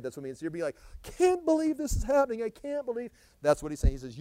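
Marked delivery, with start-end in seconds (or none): 1.0-3.1 high-pitched acted disbelief; mock distress. People leaving under judgment, acted high-pitched disbelief. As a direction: wail it like someone overwhelmed.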